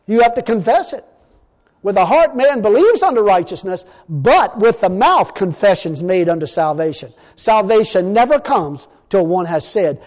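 loud, weighty scripture declaration; emphatic preaching. Real, not acted. Preacher telling church that belief must be confessed, emphatic. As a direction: hammer out each phrase of the verse.

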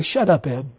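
low, curt dismissal; wife's retort. Retold or imitated. Wife Wanda silencing her husband, low and curt. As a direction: cut him off flat and low. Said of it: "Wanda say".

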